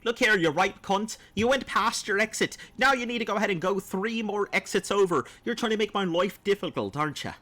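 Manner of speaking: a light Scottish brogue as a scolding sat-nav; Scottish GPS voice. Pretend. Sat-nav scolding a driver who missed the exit, light Scottish accent. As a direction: play a Scottish car GPS telling you off, lightly voiced. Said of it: "Scottish persons versus the G P S car voices".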